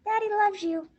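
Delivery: put-on child voice. Child voice for boys.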